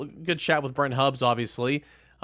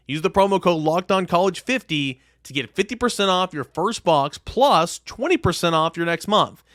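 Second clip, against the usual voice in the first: upbeat sponsor read, punchier on the promo code; 3 semitones higher than usual. Acted. Host reading a sponsor ad to podcast listeners, upbeat ad read. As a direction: sell the meal kit like a radio spot.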